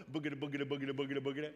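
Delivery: rapid silly running sound; a preacher mimicking a hamster wheel, silly and rhythmic; hamster noise. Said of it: "and you're going".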